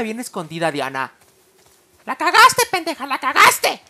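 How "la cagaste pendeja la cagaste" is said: high loud furious scolding; angry yell. Real, not acted. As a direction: yell at her for blowing it. Scolding companion who gave them away.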